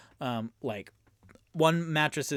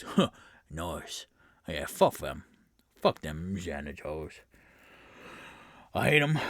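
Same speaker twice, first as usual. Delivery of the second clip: lower, gruff bandit muttering his scorn; lead bandit; 7 semitones lower than usual. Acted. Lead bandit grumbles to the new recruits about the Zents. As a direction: growl out contempt for the janitors. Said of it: "the lead bandit goes".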